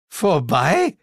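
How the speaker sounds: high rising disbelief; dismayed echo. A narrator's reading. Narrator echoes Sir James, dismayed. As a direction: repeat it aghast. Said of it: "echote ich".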